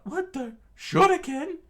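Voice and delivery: very high, loud startled cry; high cartoon crew screaming in surprise; screamed in surprise. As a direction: shriek in shock at the shurikens. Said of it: "the pirate crew screamed out".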